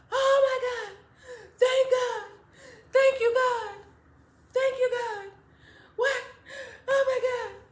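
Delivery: high, loud tearful mother; crying relief. Retold or imitated. Mother seeing her lost child home, crying relief. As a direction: wail thanks to God through tears. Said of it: "my mom is just crying".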